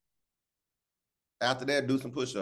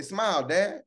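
imitating son; 6 semitones higher, slower than usual. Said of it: "My son would be like".

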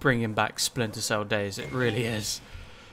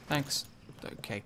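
normal talking voice

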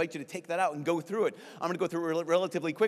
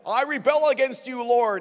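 high, loud melodramatic proclamation; mock grand defiance; 8 semitones higher, 7 dB louder than usual. Sinner theatrically defying God, mock grand defiance. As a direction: declaim it as an over-the-top rebel. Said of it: "It's not like".

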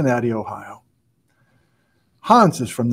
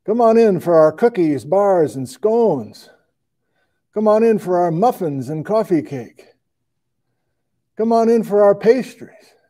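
singsong commercial pitch, rising higher; mock ad read; 5 semitones higher than usual. Host pitching the local bakery to viewers, hammed-up ad read. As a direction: sell it like a small-town radio ad.

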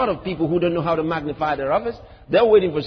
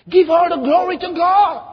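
higher, louder scolding command; 9 semitones higher, 6 dB louder than usual. Retold or imitated. Christians scolding the preacher, loud rebuke. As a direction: bark it like a scolding elder.

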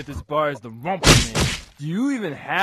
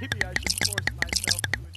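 whisper; 8 dB quieter than usual. Acted. Whispered aside.

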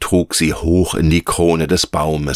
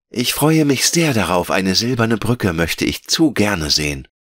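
put-on high, eager child-character voice; Veronika, voller Vorfreude; 5 semitones higher than usual. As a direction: look forward to it as an excited little girl. (freudige, höhere Kinderstimme)

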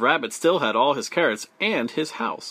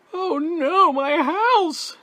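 very high, loud beaver wail; dismayed yell; 5 dB louder, slower than usual. A narrator's reading. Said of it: "yelled Beaver".

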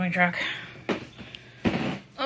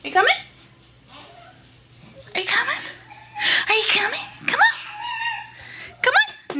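high, rising pet-talk calls; coaxing dog call. Real, not acted. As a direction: squeal an eager invitation to the dog. Calling dog to follow.

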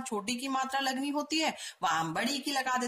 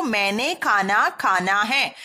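slow emphatic reading; 4 semitones lower, 8 dB louder, slower than usual. Shown as a demonstration. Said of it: "सुनने में ही कुछ अटपटा".

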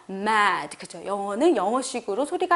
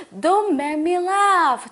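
amused; 6 semitones higher, 4 dB louder, much slower than usual. Shown as a demonstration. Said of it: "나 웃기게 하지 마".

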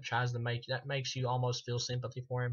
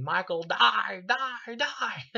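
higher, louder hammed-up "die, die"; mock furious shout; 9 semitones higher, 6 dB louder, much slower than usual. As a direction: scream it like a crazed doctor beating him. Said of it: "and saying".

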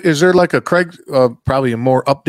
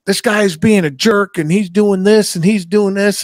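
complaining dealer; 6 semitones higher than usual. Retold or imitated. Retelling a dealer griping about a customer, higher mock complaint. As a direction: list grievances in a raised, put-on voice. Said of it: "the dealer was like".

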